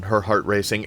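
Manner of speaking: usual speaking voice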